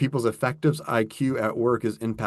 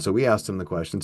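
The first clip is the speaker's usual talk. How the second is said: ordinary talk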